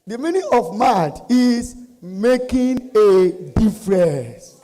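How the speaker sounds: slow, loud, high declaration; rousing proclamation. Real, not acted. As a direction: proclaim each word to the whole crowd. Proclaiming the slogan to the audience.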